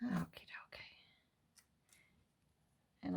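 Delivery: breathy whisper to herself; soft whispered okie dokie. Real, not acted. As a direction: whisper it as if to yourself. Crafting alone, mutters to self.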